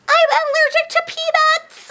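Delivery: put-on high, small boy's voice; little kid voice. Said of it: "in his little voice".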